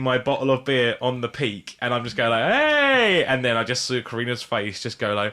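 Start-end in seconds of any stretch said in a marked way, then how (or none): 2.5-3.2 high, loud "Hey!"; excited shout. Retold or imitated. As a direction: show off your trick with a whoop. Balancing beer on cap, calling out.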